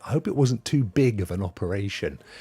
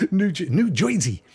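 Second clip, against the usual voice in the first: put-on playful New Jersey accent, higher; 6 semitones higher than usual. British streamer recalling where his colleague lives, New Jersey accent. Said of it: "You have that beautiful accent".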